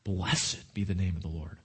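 solemn hush. Pretend. Solemn quote after pause.

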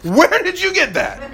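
high, slow, amazed question; dad spotting his kid's Slurpee, high and slow in mock surprise; surprised. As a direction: catch the kid red-handed, mock astonished. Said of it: "I look over, I'm like".

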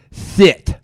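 saying it with tongue held; tongue pinched between fingers; holding tongue with fingers. Shown as a demonstration. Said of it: "hold your tongue and say shit".

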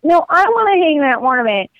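put-on high, whiny kid voices arguing; children bickering, high and pleading; squabbling kids. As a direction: play the kids fighting over ornaments. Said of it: "fighting over".

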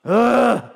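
loud, frustrated grunt; wordless grumble of frustration; grumbling noise. Shown as a demonstration. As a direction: grunt like a man home from a bad day. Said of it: "making a grumbling noise".

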